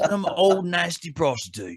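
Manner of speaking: high-pitched mock Australian presenter; hammed-up Australian accent through laughter; Steve Irwin. Co-host presents the second ghost, high Australian presenter voice. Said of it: "we went Steve Irwin".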